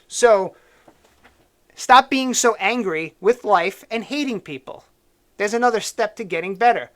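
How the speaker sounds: usual speaking voice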